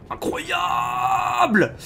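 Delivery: high, very drawn-out "incroyable"; amazed exclamation. Acted. Streamer reacting to the game's action, amazed. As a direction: stretch it out in high-pitched amazement.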